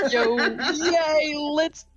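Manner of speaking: laughing while suggesting they skip driving together. Real, not acted. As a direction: giggle out the invitation. Laughing joke with friend.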